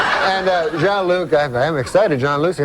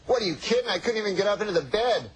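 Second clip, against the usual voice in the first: higher-pitched, incredulous protest; exasperated punchline; 4 semitones higher, 8 dB quieter, faster than usual. Acted. Midget answering his buddy about last night, exasperated. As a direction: protest in mock disbelief.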